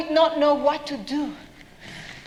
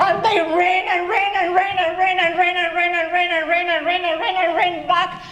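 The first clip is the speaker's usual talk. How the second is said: high, run-on breathless repetition; frantic running; 4 semitones higher, 3 dB louder than usual. A narrator's reading. Narrator tells of mice fleeing back home, frantic running. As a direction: keep the words racing without a break.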